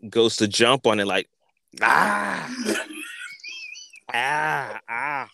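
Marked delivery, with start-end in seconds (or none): 1.8-5.3 high effortful ah cries; straining grunts. Retold or imitated. Mock strained cries as Chicken Boy leaps at the branch. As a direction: strain hard on each ah.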